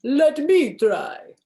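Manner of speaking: hammed-up vampire. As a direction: claim the pumpkin like a vampire. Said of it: "boasted the vampire".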